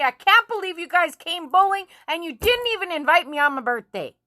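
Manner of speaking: indignant mock protest; retold tantrum. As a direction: act out a hurt birthday tantrum. Retelling a tantrum at the bowling alley.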